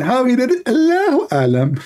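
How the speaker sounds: high mock voice shrugging off the question; mocking shrug. Mocking believers who shrug, high and sing-song. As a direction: toss it off high like a smug believer.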